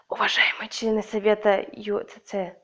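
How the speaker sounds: breathy whispered announcement; whispering. Pretend. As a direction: whisper a mock formal address. Mock formal address, whispered.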